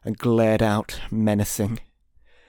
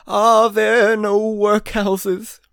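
echoing ethereal energy being; 10 semitones higher, 4 dB louder, much slower than usual. A narrator's reading. Spirit mocks Scrooge's words.

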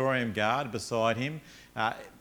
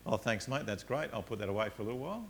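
offhand thanks; 3 semitones lower, 7 dB quieter, faster than usual. Retold or imitated. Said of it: "Just doesn't say".

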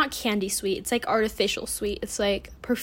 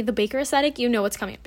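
usual speaking voice

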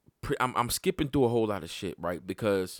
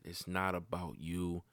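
quietly recalled; 5 semitones lower, 11 dB quieter than usual. Retold or imitated. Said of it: "what my shorty said".

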